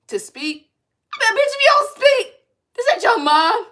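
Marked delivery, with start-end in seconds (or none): 1.2-3.7 high loud exasperated call-out; exasperated yell. Retold or imitated. To Zoom coworkers, loud and exasperated. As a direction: snap at the hand-raisers.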